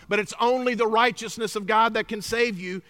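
ordinary talk, nothing marked

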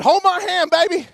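father calling to his daughter in traffic; parent to child. Retold or imitated. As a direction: coax her to take your hand on a busy street. Parent to child crossing road.

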